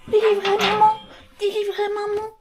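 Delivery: high, loud mock begging; pleading prisoner. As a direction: beg to be freed, playfully. Playacting prisoner begging the dogs. (supplication aiguë et théâtrale)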